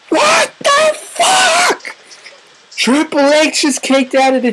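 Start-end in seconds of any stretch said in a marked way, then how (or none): 0.1-2.0 very high, drawn-out disbelief; stunned fan's high, slow exclamation; shocked outcry. Real, not acted. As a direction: stretch every word in a high-pitched cry. Shocked at the kick-out.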